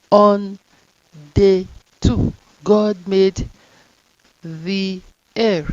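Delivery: On and day punched loud; dictation, words punched; word-by-word reading. Shown as a demonstration. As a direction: read each word loud and slow. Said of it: "bring out your tongue to call T H".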